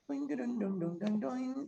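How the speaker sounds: high sung da-dun fanfare; hummed fanfare. Acted. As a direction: hum a quick fanfare up high.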